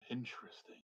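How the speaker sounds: put-on breathy whisper of a curious old wizard; hushed wizard. Wizard muses to the party in a hushed whisper. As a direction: murmur it under your breath, intrigued.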